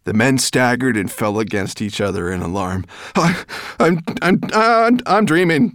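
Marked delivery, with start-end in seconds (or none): 3.2-5.7 high, loud panicked stuttering; terrified stammer. Acted. Frightened man stammering at the sight of the dragon. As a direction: trip over every word in fright. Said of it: "one of them stammered".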